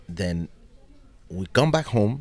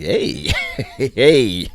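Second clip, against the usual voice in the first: loud, gleeful drawn-out hey; acted opportunistic delight; inner businessman; 6 semitones higher, 8 dB louder than usual. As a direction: laugh as if smelling a good deal. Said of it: "the businessman in me was like".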